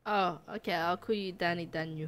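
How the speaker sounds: soft filtered little nephew voice; voice changer. Pretend. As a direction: kid voice through voice changer. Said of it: "voice changer on".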